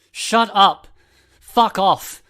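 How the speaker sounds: very loud, high screamed order; furious roar; screaming shout. A narrator's reading. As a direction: scream her silent. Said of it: "the fallen boy shouted".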